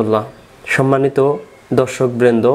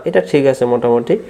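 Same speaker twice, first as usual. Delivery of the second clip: ordinary delivery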